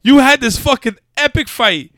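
high, loud exasperated rant; worked-up rant. Real, not acted. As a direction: rant in pent-up disbelief at the film. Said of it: "I'm pissed right now".

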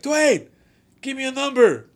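calling out. Retold or imitated. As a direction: call out to a friend far away. Calling across the casino.

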